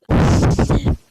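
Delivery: loud breathy laugh burst; burst of laughter. Real, not acted. Reacts to own awful joke.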